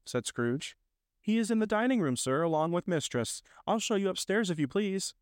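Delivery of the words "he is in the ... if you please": put-on high-pitched, polite girl's voice; servant girl. Maid directs a visitor upstairs, polite and high. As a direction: answer obligingly in a raised pitch.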